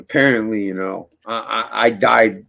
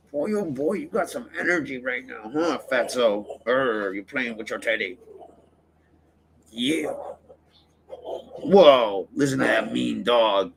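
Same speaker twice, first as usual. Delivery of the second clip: talking to the dog; 5 dB quieter than usual. Real, not acted.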